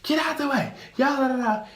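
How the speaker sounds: very high mock warnings; staff shouting warnings. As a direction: clear the way, hamming it up. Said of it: "all of us are like".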